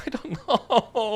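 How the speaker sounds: giggling through the words; laughing. Real, not acted. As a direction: laugh it out, high and slow. Laughing at biker club joke.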